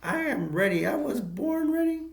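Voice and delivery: put-on high, cocky little-kid voice; cocky kid actor. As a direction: squeak it out like a brat who was born ready. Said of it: "Kevin Moore's like".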